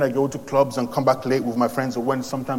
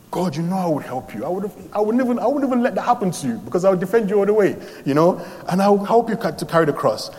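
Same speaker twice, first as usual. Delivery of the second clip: higher, eager vow; eager promise; 7 semitones higher than usual. Retold or imitated. Young man promising God to defend him, eager. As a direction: rush to promise loyalty. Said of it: "I said".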